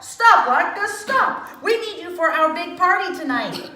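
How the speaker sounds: high loud call. A narrator's reading. The rabbi calling the latkes back, loud high call. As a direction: call after the latkes down the hall. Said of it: "he called out".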